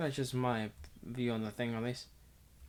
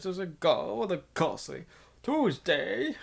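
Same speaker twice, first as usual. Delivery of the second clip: hammed-up posh British accent, pitched up; lampooning the English with a British accent; mock British accent; 5 semitones higher than usual. Mocking an English writer's word choice in a mock British accent. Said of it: "the mocking will end there".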